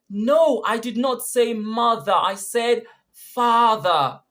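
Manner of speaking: high, loud correction with "father" drawn out; stress on father. Shown as a demonstration. Answering someone who heard mother, emphatic stress on "father". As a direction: punch "father" slowly against "mother". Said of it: "You would have stressed father".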